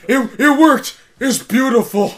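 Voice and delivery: very high, loud exclaiming; overacted jubilant shout; mock amazement. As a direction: cry out in hammed-up delight. Acting out the movie character's delight.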